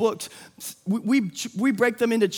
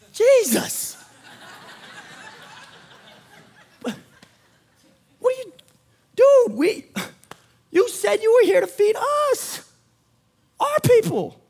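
higher, mock-exasperated disciple voice; whiny disciples; 11 semitones higher, 5 dB louder than usual. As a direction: complain to Jesus like a put-out friend. Disciples complaining to Jesus.

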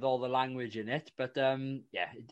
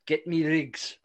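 loud, clipped South African movie villain; South African accent; 2 semitones higher, 7 dB louder, slower than usual. Acted. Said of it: "My terrible South African accent".

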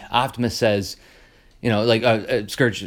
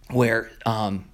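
usual speaking voice

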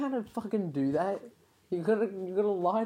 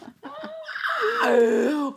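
shrieking high laugh; loud laughter; 12 semitones higher, 6 dB louder than usual. Real, not acted. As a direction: burst out laughing loudly. Laughing fit.

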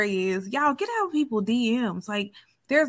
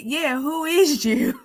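sassy challenge; 5 semitones higher, much slower than usual. Retold or imitated. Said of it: "godsister said".

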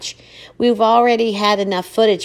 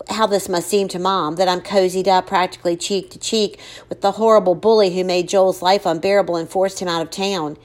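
ordinary delivery, nothing marked

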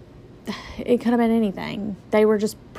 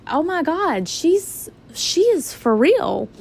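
dawning shock; 7 semitones higher, slower than usual. Retold or imitated. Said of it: "I'm like".

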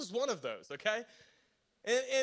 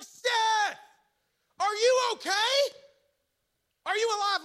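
frantic high shout, much higher and louder; hollering stepdad; 9 semitones higher, 7 dB louder, slower than usual. Retold or imitated. Stepdad hollers up at his unconscious stepson, frantic high shout. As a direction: holler up the tree in a panic. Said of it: "he's hollering".

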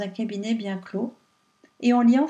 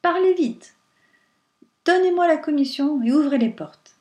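louder, higher, hurried demand; great impatience; 5 semitones higher, 5 dB louder than usual. A narrator's reading. The wind presses Pierrot to open the doors, impatient. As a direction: urge him to hurry up. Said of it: "avec une grande impatience".

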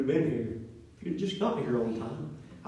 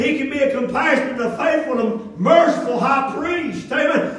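rising, louder preaching cadence; 9 semitones higher, 8 dB louder than usual. Acted. Pastor exhorting the congregation, emphatic preaching.

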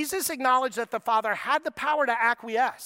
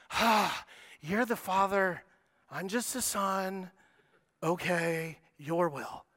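helpless submission; 4 semitones lower, 4 dB quieter, slower than usual. Retold or imitated. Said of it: "not a tone of helpless submission".

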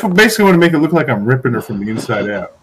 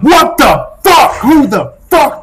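outraged shout; 8 semitones higher, 4 dB louder, slower than usual. Acted. Character yells in disgust.

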